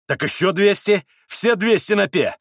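loud, high, defiant call; reckless betting. A narrator's reading. Mitya staking all two hundred, reckless shout. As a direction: throw it all in loudly.

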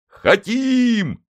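high, eager crowd yell; a whole crowd yelling yes; crowd shout. Acted. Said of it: "Крестоносцы любви дружно закричали".

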